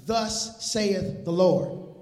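slow, weighty pulpit proclamation; slow, solemn "Thus saith the Lord"; old-time proclamation. Acted. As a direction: declare it like an old preacher. Old school term proclaimed.

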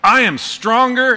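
put-on high, loud, slow kid's boast; boastful little kid. Scrawny boy boasting to a stranger, in a high loud slow boast. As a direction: puff up like a scrawny kid flexing. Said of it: "And he goes".

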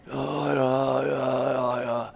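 drawn-out, wavering reluctant agreement; mock dread. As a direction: play up the nervous hesitation. Retold dread at preaching.

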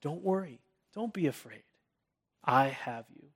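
quiet, tender comfort spoken close; God's gentle comfort, said softly; soft reassurance. Shown as a demonstration. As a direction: reassure someone softly at their ear. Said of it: "he whispers comfort and reassurance in our ear".

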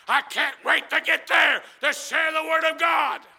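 building to full preaching. Acted. Preacher rallying Brother Jeff with rising shouts. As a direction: build to a fiery peak.

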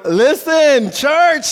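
loud high call to the congregation; Listen, church! thrown out loud and high. Real, not acted. Preacher calls out to church.

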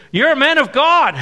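a hint of the awed deacon; excited quote. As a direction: blurt it out in amazement. Deacon voiced in retold story.

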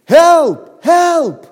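high, loud cry for help; shout for help. Retold or imitated. Man in monkey suit screams at the tiger, loud panicked cry. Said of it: "he started to shout".

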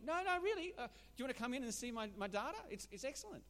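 high, loud, quick sly rabbit; a sly rabbit luring the wolf in, pitched high and talking fast; eager rabbit. Acted. Joke, rabbit lures wolf in.

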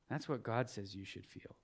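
very soft, low retold reply; quiet firm correction. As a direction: repeat it gently, dropping right down. Repeats his quiet answer to her.

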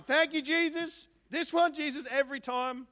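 high, loud cry of thanks to Jesus; overjoyed burst of gratitude; 1 excited thanks. Retold or imitated. Preacher voicing a grateful cry to Jesus, high and loud. Grateful prayer voiced in story.

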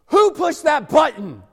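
high, loud, slow angry shout; boss shouting. Retold or imitated. Boss storming out after the cops arrive, shouting. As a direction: bellow it like a furious boss.

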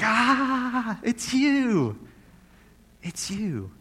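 high, delighted cry, softening at the end; joyful recognition. Pretend. God greeting his people with a joyful cry of recognition. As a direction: greet them like a long-missed loved one. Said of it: "He's just like".

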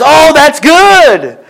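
high, slow, sing-song friend's reply; chirpy friend. Retold or imitated. Friend brightly reacting to the lady's news, high and slow. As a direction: play the cheery friend, drawn out. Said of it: "The friend says".

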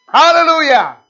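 very high, loud praise shout; saints' hallelujah. Acted. Preacher quoting Revelation's hallelujahs to rouse the church, exultant shout. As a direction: belt it out as a ringing cry. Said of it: "Those are four hallelujahs".

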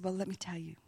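hushed whisper; a soft, confiding whisper; whispered reply. Retold or imitated. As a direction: lean in and whisper gently. Gently answering the woman.

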